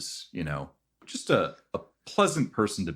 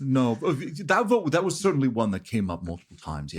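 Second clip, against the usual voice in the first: flustered NPC voice; 8 semitones higher, 4 dB louder than usual. Acted. NPC voice, high loud far.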